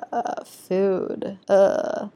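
half out of it, barely caring about food; dazed mumble. Retold or imitated. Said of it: "the half of the girls that are like".

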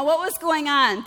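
ordinary talk, nothing marked

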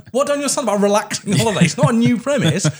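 high, fast, fed-up pleading; exasperated mate. Retold or imitated. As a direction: whine it like an impatient mate. Friend demanding a lazy beach holiday.

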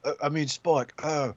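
put-on soft, confused robot in a shaky Australian-British accent; mock Aussie-British accent. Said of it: "not sure if I'm really Australian or I'm British".

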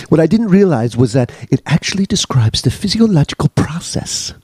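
put-on British accent; dramatic letter reading in mock English. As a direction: tell the tale as a worked-up Brit. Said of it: "I get to sound like [name] again".

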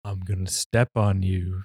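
slow, low husky threat; husky tall lady voice. Retold or imitated. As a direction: purr a slow menacing threat. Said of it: "huskier voice".

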